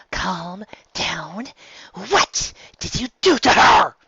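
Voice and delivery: hushed then high frantic question; whisper, then burst into a high alarmed plea; a worried friend, whispering then crying out; frantic whisper to shout. Acted.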